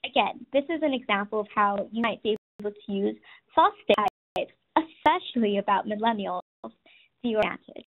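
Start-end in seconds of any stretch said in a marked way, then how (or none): none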